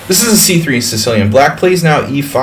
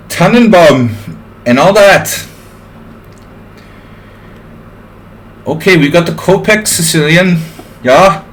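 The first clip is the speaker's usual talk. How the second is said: put-on comic German accent, pitched up; German accent as Dietrich; 2 semitones higher, slower than usual. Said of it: "Ah, I can't keep this up".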